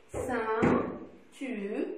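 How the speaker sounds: "Ceinture" in three long, separate syllables, final one sounded; "Ceinture" drawn out in three beats; split into three syllables. Shown as a demonstration. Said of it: "Dans quelle syllabe".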